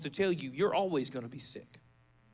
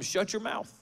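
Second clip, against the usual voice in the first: firm rebuke; 5 semitones higher than usual. Retold or imitated. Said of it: "We're telling him".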